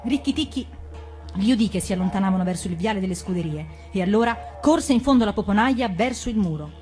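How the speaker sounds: ordinary delivery, nothing marked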